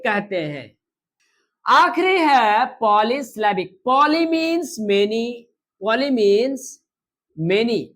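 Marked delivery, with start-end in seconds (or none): 3.9-7.8 very slow, drawn-out statement; slow teacher statement; slow teaching. Shown as a demonstration. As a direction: say it slowly so students take it in. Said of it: "teacher states the meaning slowly".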